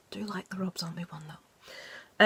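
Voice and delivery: soft confessional whisper; whispered aside. Real, not acted. To viewers, admitting a guilty pleasure in a whisper.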